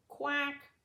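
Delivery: light duck voice; duck quack. A narrator's reading. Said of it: "Little duck called".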